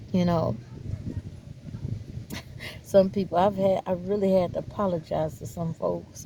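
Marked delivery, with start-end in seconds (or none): 0.6-2.8 breathless laugh. Real, not acted. Laughs at herself.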